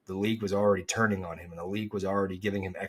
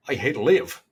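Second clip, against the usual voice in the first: put-on Irish accent; 3 semitones higher, 6 dB louder than usual. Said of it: "that's not close enough".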